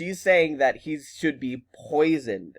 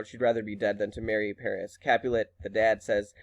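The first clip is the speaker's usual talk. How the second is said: ordinary talk, nothing marked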